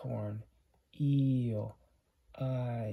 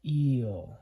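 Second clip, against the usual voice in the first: Eel with the long E leaned on, louder; stressed long E; 6 dB louder than usual. Shown as a demonstration. Teacher showing the long vowel to the class, stressed Eel. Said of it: "You're really stressing that E".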